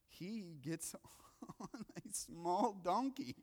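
slow, laugh-broken delivery; real laughter interrupting the line; laughing through words. Real, not acted. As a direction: crack up while getting the words out. Laughs at hilarious donkey image.

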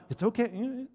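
awkward fake reassurance. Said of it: "an awkward".

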